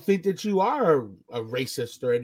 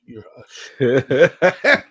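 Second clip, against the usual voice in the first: laughing insult; 3 semitones lower, 9 dB louder, much slower than usual. Retold or imitated. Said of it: "I'm like".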